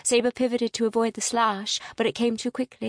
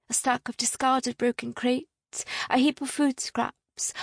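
plain reading voice

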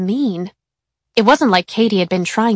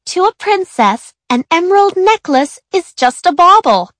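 put-on much higher, louder prissy girl; haughty princess voice; 10 semitones higher, 6 dB louder than usual. A narrator's reading. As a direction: show off like a little princess. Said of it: "she said".

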